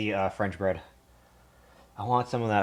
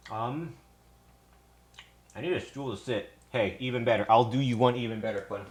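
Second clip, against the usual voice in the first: ordinary talk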